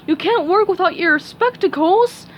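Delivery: lightly voiced dopey starfish; a hint of a dim, happy pal; Patrick voice. A narrator's reading. Patrick speaks.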